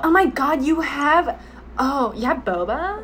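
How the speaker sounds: excited Gen Z small talk, put on; Gen Z English. Shown as a demonstration. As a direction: gush like a Gen Z girl finding a treat. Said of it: "I'll talk the English way of them".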